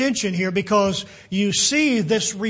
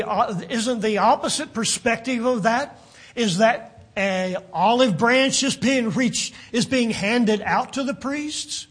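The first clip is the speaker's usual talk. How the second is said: usual speaking voice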